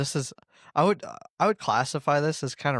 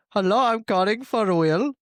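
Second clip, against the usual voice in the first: put-on higher Indian-accented call center voice; Indian telemarketer accent; 7 semitones higher, 3 dB louder than usual. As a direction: play an Indian phone scammer. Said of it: "Indian telemarketer".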